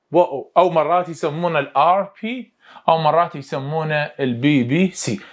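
usual speaking voice

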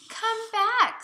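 high, loud "Come back" call. Acted. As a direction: call after the parrot flying off. Dad calls the flying parrot.

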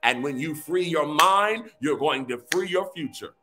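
fervent prophetic decree over music; fiery preacher declaring over the people; preaching. Acted.

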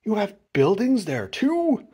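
slow, high, wondering question; stretched-out surprise; gasped amazement. A narrator's reading. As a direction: ask in astonishment, rising high. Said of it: "Horton gasped".

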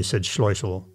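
ordinary delivery

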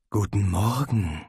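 low, inviting seductive purr; sultry invitation. A narrator's reading. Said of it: "klang es wie eine Einladung ins Bett".

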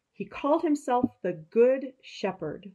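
ordinary talk

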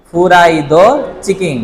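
ফুরাইদো চিকিং said slowly, each mora separate; slow Japanese reading. Shown as a demonstration. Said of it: "চিকিং মানে কি?".

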